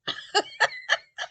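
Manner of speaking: a squeaky laugh that leaps way up; high burst of laughter. Real, not acted. Vlogger to viewers, laughing high at her own joke. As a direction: crack up at your own corny punchline.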